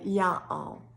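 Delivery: "y'all" split in two, ya-all; dialect "ya all". Shown as a demonstration. Showing another holler's word, "y'all" in two beats. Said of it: "another holler would say y'all".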